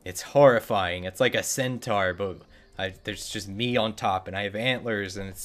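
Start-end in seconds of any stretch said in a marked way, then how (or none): none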